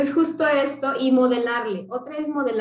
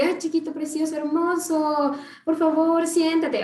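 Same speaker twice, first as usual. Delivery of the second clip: syrupy coaxing, higher pitch, stretching hermoso; mock honeyed plea to a little one; overly sweet pleading; 4 semitones higher than usual. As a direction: beg a child sweetly, too sweetly. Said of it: "Ay, ya se te perdió el niño".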